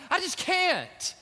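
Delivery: slow, high, frightened refusal; scared boy at the ravine, slow and high; fearful. Retold or imitated. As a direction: drag out the refusal in dread. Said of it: "And he's just fearful".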